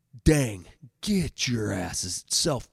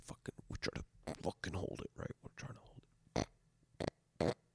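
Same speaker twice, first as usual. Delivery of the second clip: whisper; 8 semitones lower, 16 dB quieter than usual. Real, not acted. Recruits stifling laughter.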